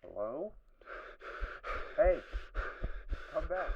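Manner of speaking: high pleading call. Acted. Lost survivor calls out for someone to come back, pleading. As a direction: call after someone leaving. Calling after something.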